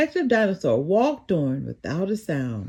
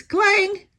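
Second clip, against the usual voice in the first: clang noise; 12 semitones higher, 4 dB louder than usual. A narrator's reading. Sudden noise in the alley.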